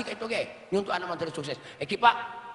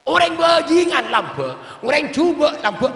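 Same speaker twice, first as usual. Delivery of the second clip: preaching shout; 11 dB louder than usual. Acted. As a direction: hammer the point home to the crowd. Preacher's climactic shouted point. (seruan keras penceramah, tinggi dan lantang)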